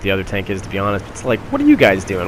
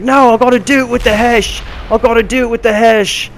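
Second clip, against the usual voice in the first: much higher, louder put-on British voice; mock British accent; 13 semitones higher, 6 dB louder than usual. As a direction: ham up the stubborn British teammate. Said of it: "He's like".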